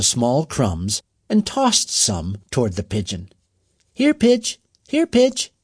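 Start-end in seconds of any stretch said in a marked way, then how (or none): 3.9-5.5 high, loud call across the lawn. A narrator's reading. Boy calling to a hurt pigeon across the grass, high and loud. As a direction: call the bird over, bright and high. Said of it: "he called".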